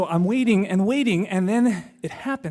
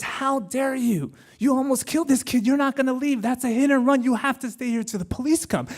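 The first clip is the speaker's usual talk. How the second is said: higher, indignant scolding of the driver; scolding stranger; 7 semitones higher than usual. Retold or imitated. Stranger scolding the bus driver after the hit, indignant. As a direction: tell the driver off for almost killing a kid. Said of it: "this Mexican dude is scolding".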